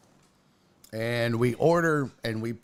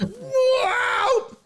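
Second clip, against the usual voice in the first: imitated swerve noise; loud, drawn-out high wail for a swerving car; high swooping whoop; 4 dB louder than usual. Aunt turns left from center lane, swooping whoop.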